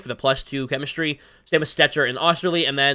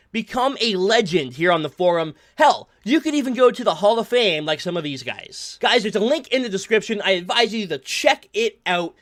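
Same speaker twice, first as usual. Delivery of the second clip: upbeat, pitched-up sponsor pitch; sponsor ad read; 3 semitones higher than usual. Acted. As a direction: sell the league like a friendly ad.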